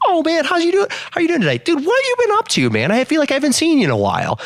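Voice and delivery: lively inflection. Retold or imitated. Coworker greeting a regular customer, high and eager. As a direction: greet him like an old buddy you missed. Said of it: "but with different inflection in his voice".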